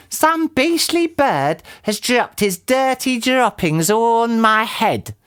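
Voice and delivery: high, drawn-out indignant wail; indignant cry. A narrator's reading. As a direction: cry it slowly in high disgust. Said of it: "cried Missus Twit".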